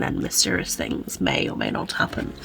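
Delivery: a hushed, mysterious whisper; spooky whisper. Pretend. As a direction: whisper it like a ghost story teaser. Teasing what happens in the book.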